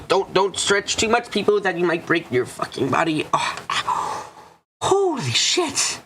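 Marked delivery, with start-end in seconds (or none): 3.3-6.0 breathless high gasp of pain. Real, not acted. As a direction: gasp through the hurt. Pain from overstretching.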